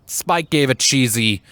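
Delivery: plain reading voice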